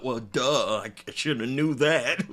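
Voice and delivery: mock dumb voice.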